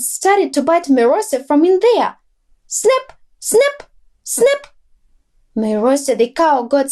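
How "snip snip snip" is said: very high, tiny snipping voice; biting flea. A narrator's reading. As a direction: snip like a tiny biter. Flea biting inside nose.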